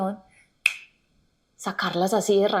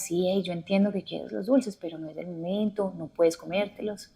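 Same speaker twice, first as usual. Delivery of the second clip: put-on soft, gentle explaining to a kid; empathetic to a child; 3 semitones lower, 8 dB quieter than usual. Softer, patient talk to a child about candy. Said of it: "si tú empatizas con él y le explicas".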